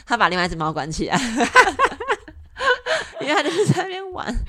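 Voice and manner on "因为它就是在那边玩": laughing. Real, not acted. Laughing hard at the trapped cat.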